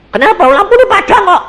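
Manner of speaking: high, loud, quick retold question. Husband questions wife about the lamp, high quick retort. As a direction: throw back an insistent question, imitating a couple's exchange.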